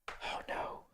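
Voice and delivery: breathy whispered oh no; whispered dread. Real, not acted. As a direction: whisper it in mock despair. Dreading opening big file.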